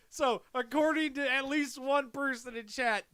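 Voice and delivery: normal talking voice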